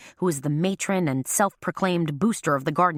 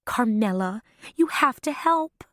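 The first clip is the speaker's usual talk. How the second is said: pained plea; 7 semitones higher than usual. A narrator's reading. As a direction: beg her friend for help. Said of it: "her voice registering the same pain".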